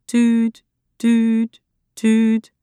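high, evenly spaced tuut, tuut, tuut; a mock phone beep voiced by the narrator; imitated dial tone. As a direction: mimic a busy phone signal. Phone line goes dead.

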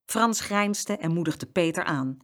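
ordinary delivery

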